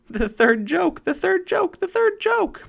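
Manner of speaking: high, loud squawking jackdaw, rising each time; great excitement. A narrator's reading. Jackdaw crows at the bear's fall, wildly excited. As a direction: crow it out like a thrilled bird. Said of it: "said the jackdaw in great excitement".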